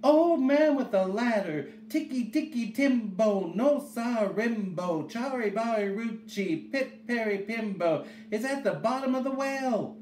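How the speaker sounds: put-on high, desperate child's call, loudest at the start; urgent high little-boy holler; shouted. A narrator's reading. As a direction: shout with your last ounce of breath, high-pitched. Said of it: "he shouted".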